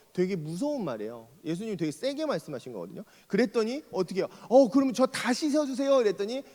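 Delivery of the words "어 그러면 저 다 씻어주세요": eager plea. Retold or imitated. Eager Peter asks wash all.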